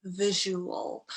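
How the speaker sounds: "visual" said slowly as VIZH-oo-ul; model pronunciation. Shown as a demonstration. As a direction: clear "visual" with a zh sound. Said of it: "make sure that U and that A".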